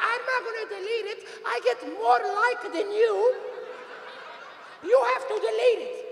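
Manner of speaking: high, stubborn mother voice; imitated mother. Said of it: "And she goes".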